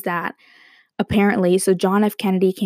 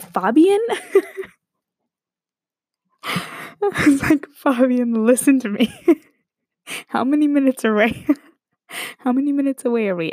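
higher voice, breaking into laughter; laughing incredulity; 6 semitones higher than usual. Retold or imitated. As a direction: plead with the driver through giggles. Laughing through retold exasperated reply.

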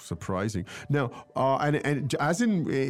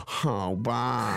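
imitated whiny, grudging "fine then"; mock self-pitying child conceding; whining. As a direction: whine it like a child forced to give in. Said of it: "With a whining tone".

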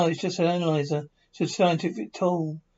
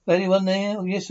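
ordinary talk

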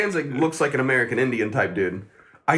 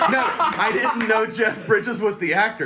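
laughing; 5 semitones higher than usual. Real, not acted. Laughing at his own mix-up.

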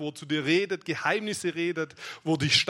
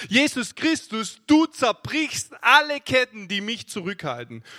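forceful preached proclamation; 3 semitones higher, 6 dB louder than usual. Acted. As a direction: proclaim each title with full conviction. Proclaims Christ's lordship in declarations.

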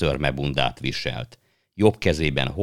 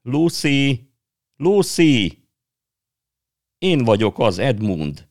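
high, loud calling across the snow; boy calling for his lost sister; shouting; 9 semitones higher, 6 dB louder, slower than usual. Acted. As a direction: call out his sister's name, fading at the end. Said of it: "újra kiabálni kezdett".

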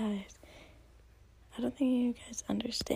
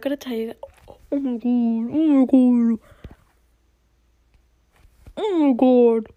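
screaming with excitement; shrieking jackpot winner; 14 dB louder than usual. Real, not acted. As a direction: shriek it out, thrilled. Gets text from college coach.

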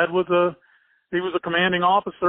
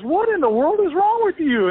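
high, baffled fatherly outburst, imitated; a bewildered father demanding an answer; exasperated dad; 12 semitones higher, 3 dB louder than usual. Dad asking the crying boy, imitated baffled exasperation.

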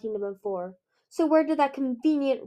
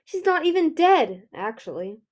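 high, loud start dropping to an offhand aside; airy; 5 semitones higher, 5 dB louder than usual. A narrator's reading. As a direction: toss off the revelation casually. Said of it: "Queen Scarlet said airily".

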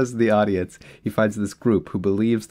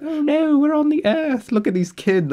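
high-pitched mocking disaster victim; mock disaster-movie panic. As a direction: squeal it like a hammy movie character. Mocking typical disaster movie dialogue.

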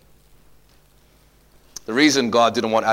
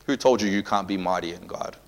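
normal talking voice

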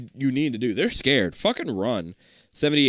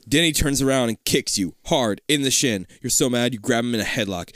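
usual speaking voice

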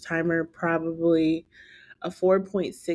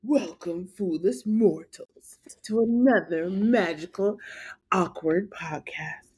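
slow eerie villain greeting, fading to a whisper; dark lord. Acted. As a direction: welcome the mortals like a sinister host. Foolish mortals" spooky host greeting.

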